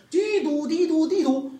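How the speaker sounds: sung high siren sound, slow; siren imitation. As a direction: mimic a police siren, nee-naw.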